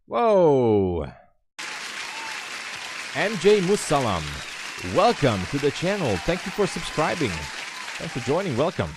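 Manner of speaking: high, loud, delighted shout-out; excited welcome. Real, not acted. Streamer thanking a new subscriber for joining, excited.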